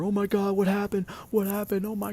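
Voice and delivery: high, alarmed gasping cries; a mom panicking over a hurt child; friend's mom shrieking in alarm; shocked mom. Retold or imitated. Friend's mom finding hurt kid.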